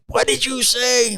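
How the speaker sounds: mock shocked "what did you say"; retold startled outburst. Telling of snapping at the store clerk, startled.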